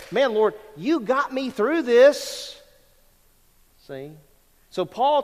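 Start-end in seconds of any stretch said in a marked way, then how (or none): 0.1-2.6 fervent testimony. Pretend. Imagined testimony exclaim.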